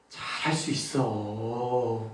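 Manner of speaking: low, soft and very slow, long drawn-out 있어; gently waving ending. Shown as a demonstration. Coach encouraging gently, swaying drawn-out ending. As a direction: stretch it out tenderly to the end. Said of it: "마지막에 한번 흔들어 줄 수 있는".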